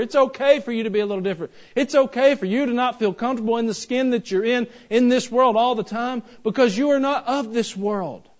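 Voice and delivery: full-force sermon voice; fiery preaching. Acted. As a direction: drive each phrase home to the congregation.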